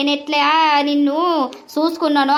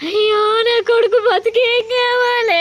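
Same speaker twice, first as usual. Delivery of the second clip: wailing; 5 semitones higher than usual. Acted. Mother wails over son.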